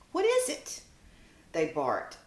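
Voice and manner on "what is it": very high, startled little dog; alarmed puppy voice. Acted. Puppies barking at the approaching bird. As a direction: shoot the pitch up in alarm.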